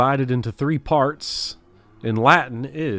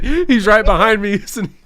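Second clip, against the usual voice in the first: mock dread Caesar; 5 semitones higher, 5 dB louder than usual. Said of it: "When he said".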